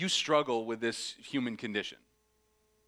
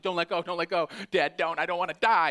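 panicked pleading; 4 semitones higher, 5 dB louder than usual. Retold or imitated. Child panicking on bike.

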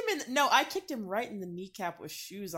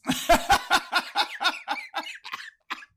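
breathless high wheezy laughter; wheezing laugh; 11 semitones higher, 7 dB louder than usual. Real, not acted. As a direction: crack up in silent wheezes. Big laugh at joke.

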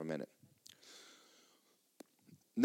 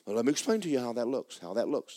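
ordinary talk, nothing marked

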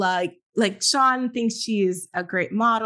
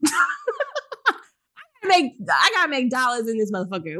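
exasperated; 5 semitones higher than usual. Pretend. Voicing Tracy's defence.

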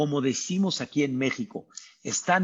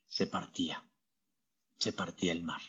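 hushed awe; 8 semitones lower, 11 dB quieter, faster than usual. Acted. Awe at the sea parting.

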